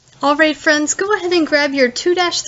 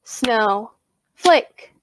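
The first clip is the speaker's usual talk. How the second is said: "snowflake" as two detached beats; split into syllables; 2 semitones lower than usual. Shown as a demonstration. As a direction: pause between "snow" and "flake" in "snowflake". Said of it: "Clap that with me now".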